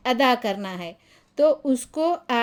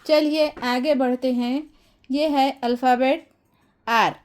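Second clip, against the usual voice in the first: ordinary delivery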